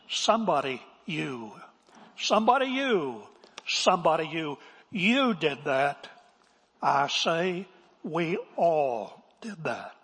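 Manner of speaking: drawn-out, accusing pulpit refrain; W A Criswell. Retold or imitated. Preacher quoting his pastor's favorite phrase, imitated dramatic oratory. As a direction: drive the refrain home, slowing into a grand verdict. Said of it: "and this was one of his phrases".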